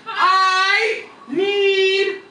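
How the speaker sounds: very slow, high, loud sung-out words; sing-song drawn-out call. Retold or imitated. Grandfather calls to his wife again, slow sung yell. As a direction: stretch it like a song.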